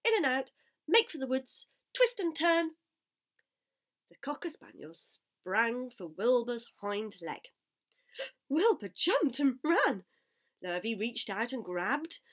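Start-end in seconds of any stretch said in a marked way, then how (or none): 0.0-2.8 loud, high string of commands; bossy, excited shouted instructions; giving orders. A narrator's reading. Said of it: "began to give orders". 8.5-10.0 gasp, then loud, high burst; dramatic, raised storytelling; excited narration. A narrator's reading. Narrator at height of chase.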